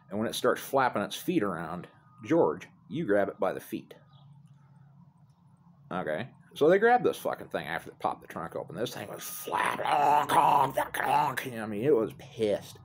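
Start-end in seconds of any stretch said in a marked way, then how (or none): 2.3-6.3 hushed, conspiratorial order; whispered instruction. Retold or imitated. Telling George how to grab the goose, whispered. Game plan for catching goose. 9.9-11.5 imitated honking, higher and louder; goose honks. The trapped goose thrashing, imitated honking.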